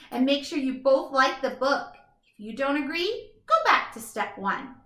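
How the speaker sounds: plain reading voice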